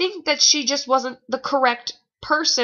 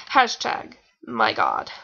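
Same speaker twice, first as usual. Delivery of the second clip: mock deep hashtag; slower than usual. Mock dramatic aside.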